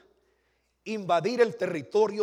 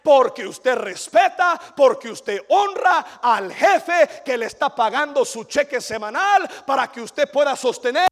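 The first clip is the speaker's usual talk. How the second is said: slow, loud, driving sermon shout; preaching at full force; 8 semitones higher, 16 dB louder, slower than usual. Acted. Preacher to congregation, a loud preaching shout. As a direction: hammer each word home to the pews.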